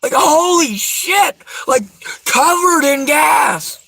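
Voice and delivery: horrified shout at the memory; shouted disbelief. Real, not acted. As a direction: drag it out in a shout. Reliving the near explosion.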